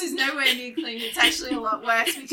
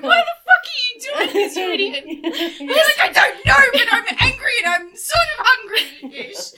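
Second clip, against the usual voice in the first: much higher, louder squeaky inner self; inner voice scolding; 12 semitones higher, 7 dB louder than usual. Retold or imitated. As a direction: voice a frantic little inner critic, laughing. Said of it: "'Cause you're like".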